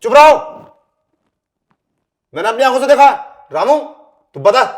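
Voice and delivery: very loud, high yell to silence her; husband yelling at his wife to shut up, furious; furious shout. Acted.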